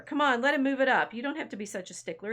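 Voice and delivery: high, loud coaxing that eases off; mildly acted protest. History Bear argues to bend the rules, mildly protesting. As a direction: push back on a fussy player, lightly acted.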